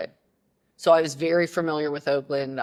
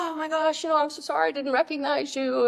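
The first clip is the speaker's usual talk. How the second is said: much higher, gushing apology; apologetic coach; 12 semitones higher than usual. Retold or imitated. Coach apologizes to player she forgot, high and gushing. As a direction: coach embarrassed, overdoing the sorry.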